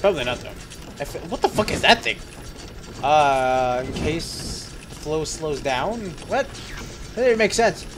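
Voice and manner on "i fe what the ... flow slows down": normal talking voice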